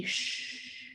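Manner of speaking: put-on voiceless shh, as if to a baby; a quick soft hush for a sleeping baby. Hushing the baby in the picture.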